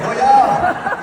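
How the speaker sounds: put-on high, loud laughing Minnesota accent oh yeah; cartoon Minnesotan chirp. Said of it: "It's like".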